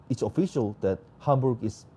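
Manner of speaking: usual speaking voice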